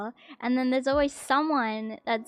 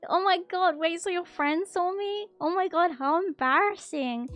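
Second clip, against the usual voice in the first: higher, flustered oh my god; higher flustered embarrassment; embarrassed shock; 6 semitones higher, 3 dB louder than usual. Real, not acted. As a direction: squirm with secondhand embarrassment. Reacting to an embarrassing confession.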